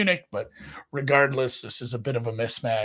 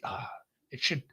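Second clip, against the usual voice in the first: normal talking voice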